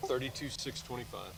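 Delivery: barely audible, soft reply; too quiet. Real, not acted. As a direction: say it much too softly. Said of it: "you're really quiet".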